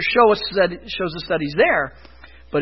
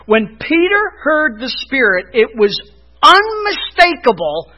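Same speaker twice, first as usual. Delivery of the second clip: loud, raised-pitch sermon delivery; emphatic preaching; 5 semitones higher, 4 dB louder than usual. Real, not acted. Preacher arguing to his church that miracles were for then, emphatic preaching. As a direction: drive each point home with punched key words.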